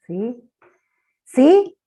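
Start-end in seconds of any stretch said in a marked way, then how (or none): 1.2-1.8 high, loud prompting question; pregunta insistente. Real, not acted. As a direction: call out for a response, pitch jumping up. Insiste porque nadie le responde. (pregunta insistente, aguda y fuerte)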